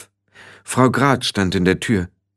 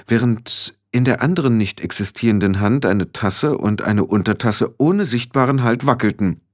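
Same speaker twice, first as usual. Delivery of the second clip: plain reading voice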